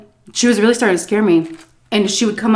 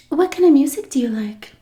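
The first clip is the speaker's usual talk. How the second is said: higher, breathy-sweet Marilyn Monroe style; sweet baby-doll voice; 7 semitones higher than usual. Retold or imitated. Narrator imitating the old driver's sweet question. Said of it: "the sweetest baby doll, Marilyn Monroe kind of voice".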